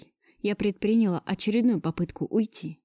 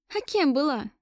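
higher-pitched, wryly sad question; sad chuckle; 6 semitones higher than usual. A narrator's reading. Woman answering lover who denies she was mistress, sadly wry. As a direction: ask with a touch of sad irony. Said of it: "грустно усмехнулась я".

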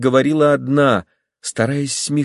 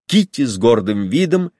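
ordinary delivery

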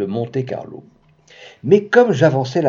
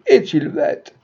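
mocking croupier; 6 semitones higher, slower than usual. A narrator's reading. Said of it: "criait le croupier".

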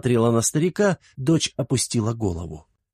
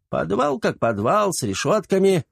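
put-on old servant voice; 9 semitones higher than usual. A narrator's reading.

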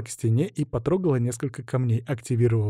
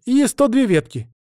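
high, loud added item; reporting novice; 11 semitones higher, 6 dB louder than usual. A narrator's reading. Novice completes the damage report, high and emphatic. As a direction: add the last count high and emphatic. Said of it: "отчиталась послушница".

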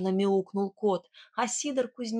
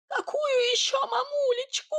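very high squeaky little-man voice; tiny piping Sidor voice; squeaking. Acted. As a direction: squeak it out indignantly. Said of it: "пропищал Сидор".

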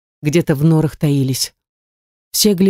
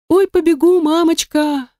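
put-on frightened child; 10 semitones higher than usual. A narrator's reading.

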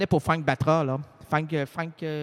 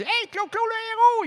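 shouting his name, much higher; calling out. Retold or imitated. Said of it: "on l'appelle, on dit".

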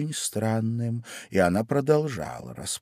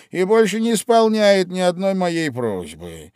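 high, loud, excited Hatter; a lively know-all Hatter; loud, high, bustling Hatter; 13 semitones higher, 11 dB louder than usual. A narrator's reading. Hatter continues.

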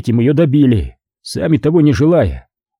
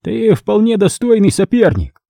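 high, composed cardinal voice; self-possessed cardinal; 9 semitones higher than usual. Acted. Cardinal acknowledging a worthy rival, composed and high-pitched. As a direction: play the cardinal back in control, pitched high. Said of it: "собранного и владеющего собой".

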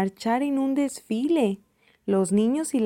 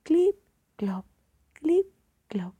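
donkey hoofbeats; 6 semitones higher than usual. A narrator's reading. The donkey starts walking, imitated hoofbeats. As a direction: voice hoof steps with short gaps. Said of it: "comienza a escucharse de nuevo".

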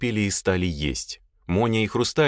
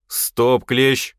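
loud, raised commanding call; sharp halt; 5 semitones higher, 6 dB louder than usual. Acted. Boss halts the lad's flow of words, sharp halt. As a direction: cut him off firmly.

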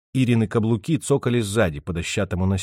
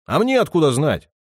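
snapping back; 5 semitones higher, 4 dB louder than usual. Acted. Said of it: "огрызнулся Антон".

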